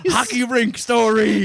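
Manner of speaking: high, slow call from the crowd; mock heckler shout. Audience member calling out a request, slow and high.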